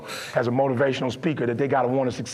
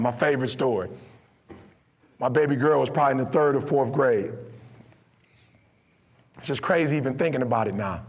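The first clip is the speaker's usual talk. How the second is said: ordinary talk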